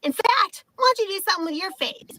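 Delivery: high-pitched taunting comeback; mocking retort. Woman turning the insult on ID checker, high mocking retort. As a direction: throw it back at him, pitched high. Turning the mockery back on him.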